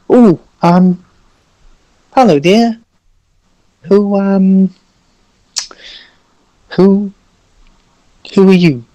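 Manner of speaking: high, halting, polite voice; timid, odd-talking golem. Acted. Golem greeting the projected figure, timid and high. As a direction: hesitate shyly between words, pitched well up. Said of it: "why are you talking like that".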